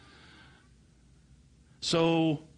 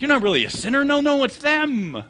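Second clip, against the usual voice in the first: exasperated mock shout; 14 semitones higher, 8 dB louder than usual. Preacher waving off the old ladies as non-sinners, exasperated mock yelling. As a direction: wail it in hammed-up frustration. Acted preacher redirecting the blame.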